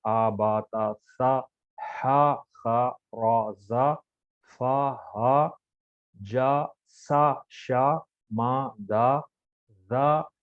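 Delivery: आ बा ता सा, each with a clear open a; slow syllable-by-syllable recitation; zabar drill. Shown as a demonstration. Teacher leads students through the zabar letters, slow drill. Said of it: "ये पूरे जबर की मश्क थी".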